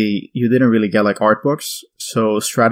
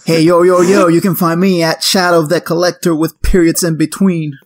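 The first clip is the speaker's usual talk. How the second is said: hyped, loud, higher influencer plug; 7 semitones higher, 4 dB louder than usual. Acted. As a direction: shout out your handle like an influencer. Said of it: "That's my influencer voice as well".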